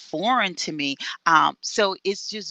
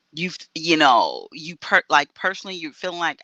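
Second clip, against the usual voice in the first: normal talking voice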